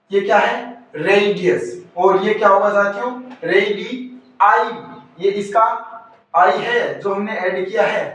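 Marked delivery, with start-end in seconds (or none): 3.4-4.7 रेडी आई said slowly, "eye" set apart; slow रेडी, then a separate आई; separated ending. Shown as a demonstration. Teacher showing the added letter's own sound, split slowly. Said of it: "इसका अलग से pronunciation होगा".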